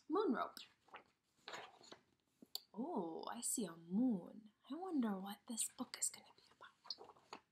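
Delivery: a soft whispered wonder; hushed wondering. Acted. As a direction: whisper your curiosity to the kids. Teacher wonders in a hush.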